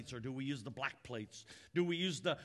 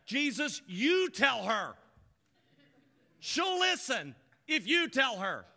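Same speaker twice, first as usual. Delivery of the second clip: high, loud, insistent demand; demanding Martha; 8 semitones higher, 7 dB louder, slower than usual. Acted. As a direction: push the order hard at Jesus. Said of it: "She doesn't go there".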